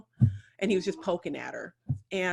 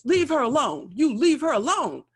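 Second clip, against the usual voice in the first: loud, high command to back off; protective shout; 6 semitones higher, 8 dB louder than usual. Retold or imitated. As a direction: shout him away from her. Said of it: "runs up and goes".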